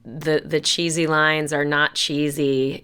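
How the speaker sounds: usual speaking voice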